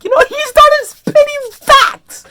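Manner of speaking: very high, loud squeal; loud high-pitched gushing; hyped outburst. Real, not acted. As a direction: squeal it with total fan excitement.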